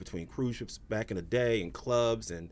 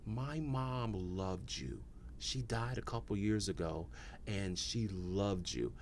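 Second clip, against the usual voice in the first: slow, soft voice of a grieving daughter; hushed, understated sorrow; retold tearful tribute; 4 semitones lower, 9 dB quieter, slower than usual. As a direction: imitate her gently, weighing each phrase. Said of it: "And she starts crying".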